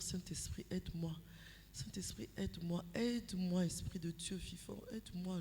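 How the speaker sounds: hushed intercession to the Holy Spirit; silent inner prayer. Retold or imitated. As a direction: pray under your breath. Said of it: "intercéder dans mon cœur".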